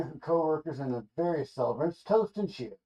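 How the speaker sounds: ordinary talk, nothing marked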